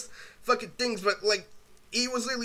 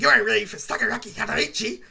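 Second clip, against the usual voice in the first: imitating brother; 2 semitones higher, 4 dB louder than usual. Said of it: "he says this shit like".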